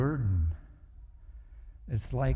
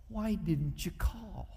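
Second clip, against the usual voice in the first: reproachful wife; 6 semitones higher, slower than usual. Retold or imitated. Wife confronting late husband.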